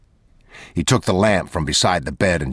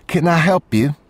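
higher clerk's polite question; motel clerk woman; 11 semitones higher, 3 dB louder than usual. Acted. Motel clerk asking the late customer, higher woman's voice. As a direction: voice the woman behind the desk, higher. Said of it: "she said".

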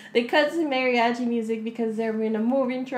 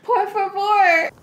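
loud drawn-out por favor; 9 semitones higher, 9 dB louder, slower than usual. Retold or imitated. Girlfriend saying por favor.

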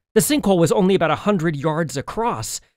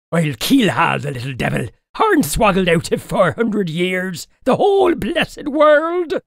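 put-on muttering old man; 4 semitones higher, 4 dB louder than usual. A narrator's reading. Old man pacing after losing his captive, indignant muttering. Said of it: "he muttered to himself".

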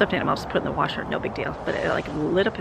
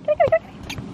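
very high sing-song kitty call; calling the cat; much faster than usual. Real, not acted. Mother calling the cat to come see the bear, very high sing-song. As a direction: coax the cat over sweetly.

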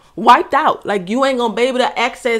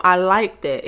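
ordinary talk

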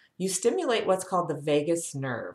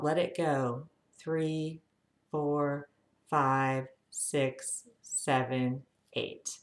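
guided breathing count; much slower than usual. Acted. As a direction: ease them into calm, count by count. Leads slow breathing exercise.

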